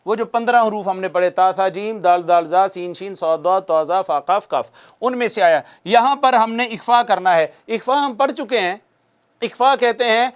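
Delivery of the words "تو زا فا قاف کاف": usual speaking voice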